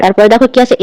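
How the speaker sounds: usual speaking voice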